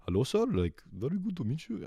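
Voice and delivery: high-pitched, badly faked Indian accent; terrible Indian accent. Tech support agent greeting a caller, bad Indian accent. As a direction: attempt an Indian accent and botch it. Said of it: "My Indian accent's terrible, but they're like".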